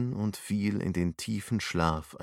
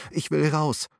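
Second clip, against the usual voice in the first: high, frightened cry to get out; terrified shout; panicked cry; 8 semitones higher than usual. A narrator's reading. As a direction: yell in fear, higher. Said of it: "schrie er erschrocken".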